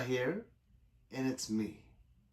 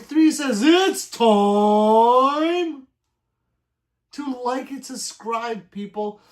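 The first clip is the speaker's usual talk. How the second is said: louder and higher, hyping the line; loud hype read; 7 semitones higher, 10 dB louder, much slower than usual. Real, not acted. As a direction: shout it out to the viewers. Reading chat loudly.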